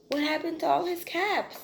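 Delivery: rising alarm, wide-eyed. A narrator's reading. Peddler finds caps gone.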